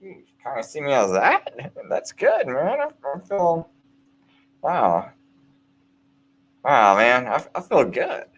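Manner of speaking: a hint of cheerful delight; pleased, unbothered. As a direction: shrug it off with a grin you can hear. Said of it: "turn it around on the person and be like".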